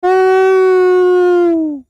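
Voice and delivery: high loud whoop of delight; excited whoop. Real, not acted. Streamer cheering a good card pull, loud excited whoop.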